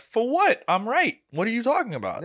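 high-pitched, incredulous retort; indignant protest. Retold or imitated. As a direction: push back with pitch shooting up. Retold protest at being told to apologize.

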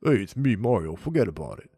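loud, hammed-up Brooklyn guy; Brooklyn accent. Host imitating a live-action Mario, loud Brooklyn accent. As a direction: punch it out like a Brooklyn tough. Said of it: "kind of the Brooklyn accent".

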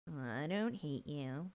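slow, low, soft huffy denial. Retold or imitated. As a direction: draw it out quietly, imitating a huffy man.

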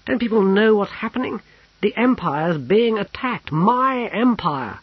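high, loud indignation; indignant outrage. A narrator's reading. Officer hearing his empire is attacked, loudly outraged.

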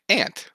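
"aunt" with a short a, rhyming with "pant"; aunt as ant. Shown as a demonstration. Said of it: "it's an ant".

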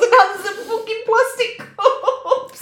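high, drawn-out incredulous squeal; laughing mock outrage. Co-host mocking the film's cheap props, in laughing mock outrage. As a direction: stretch it out high, cracking into laughter.

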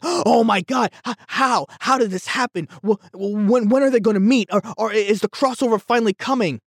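high, quick excited gasps of questions; excited fan. Retold or imitated. Fan reacting to the trailer, high and excited. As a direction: gush like a fan seeing the tease.

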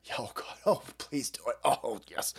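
soft, low hammed-up swooning; mock ecstasy. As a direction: plead softly in pretend bliss.

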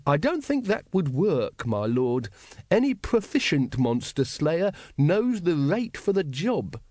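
high-pitched, measured secretary's voice; a careful underling, pitched high and unhurried; deferential secretary. Acted. Secretary advises his lord on monster slayers' rates, deferential.

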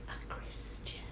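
secretive whisper, confessing. Real, not acted.

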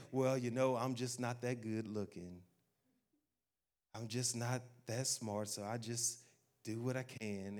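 low, soft, slow resignation; acted self-deprecation. Preacher voicing someone living in insecurity, quietly resigned. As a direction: play someone meekly giving up on themselves.

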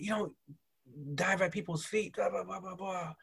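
high, softened mock scolding; retold yelling. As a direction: mimic a coach's telling-off without the volume. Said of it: "I was getting yelled at".

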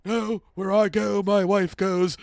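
put-on slow, loud, vaguely UK accent; lightly put-on British-Irish accent, drawn out; mock British-Irish accent. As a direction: imitate him in a muddled British accent. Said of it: "is an inconsistent accent".

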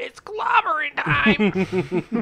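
high, loud, very slow, drawn out; hammed-up superhero catchphrase. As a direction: stretch the catchphrase like a hero. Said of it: "He's like".

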